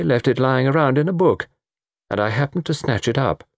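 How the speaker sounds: lightly voiced cavalier young man; nonchalant confessor. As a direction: admit it airily.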